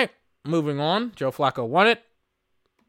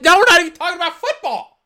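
exasperated outburst; 12 semitones higher, 7 dB louder than usual. Real, not acted. Fed up watching awards show.

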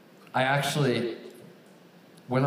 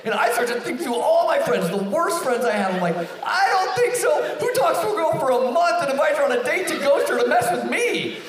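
laughing while telling; 5 dB louder than usual. Real, not acted. Laughing through retelling the prank.

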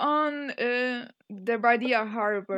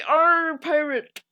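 mock pirate growl on "Arr", pitched up; 4 semitones higher, 5 dB louder, much slower than usual. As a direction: play a loud stock pirate. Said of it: "Who's very".

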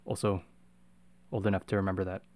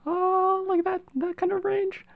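very high falsetto; slower than usual. Shown as a demonstration. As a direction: lift it way up into falsetto. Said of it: "falsetto range".

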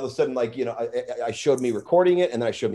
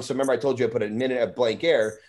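normal talking voice

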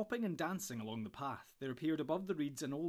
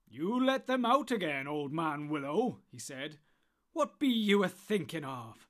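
loud, high, stern command; commanding Tom; 3 semitones higher, 7 dB louder, slower than usual. Acted. Tom Bombadil scolding the willow loudly. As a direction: order the tree sternly.

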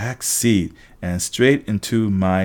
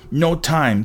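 high, brief cry; 6 semitones higher than usual. A narrator's reading. Tempest brushes off the question while speeding, high cry. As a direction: call it out in raised pitch. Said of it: "Tempest cried".

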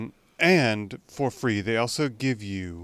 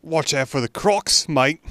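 hammed-up Australian accent, higher and louder; a playful stab at an Australian accent, higher and louder; 6 semitones higher, 6 dB louder than usual. To co-host, joking about Australian listeners, in a hammed-up Australian accent. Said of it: "that's my Australian".

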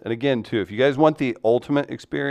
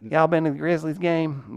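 lightly put-on Memphis Southern accent, pitched higher; 6 semitones higher than usual. Shown as a demonstration. Host asked for Memphis accent, higher mock Southern voice. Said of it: "How would you do a Memphis accent".

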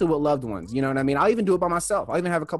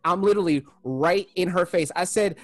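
normal talking voice

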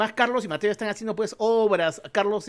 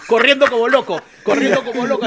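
laughing; 2 semitones higher, 7 dB louder than usual. Real, not acted. Laughing at the Vizcarra image.

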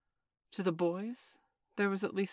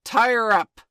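very loud, higher shout; 5 semitones higher, 17 dB louder than usual. Acted. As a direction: bellow the order. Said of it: "he shouted".

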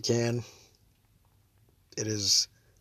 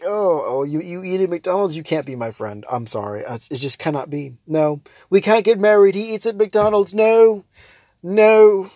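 hammed-up shocked snob, building to loud refusals; mock outrage; 7 semitones higher, 6 dB louder than usual. Mocking snobs who shun McDonald's eaters, hammed-up outrage. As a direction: play a scandalised snob getting louder.

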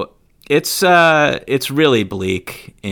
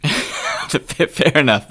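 fair enough through real laughter; slow, laughing it out; 6 semitones higher, much slower than usual. Real, not acted. Laughs at cohost's spin.

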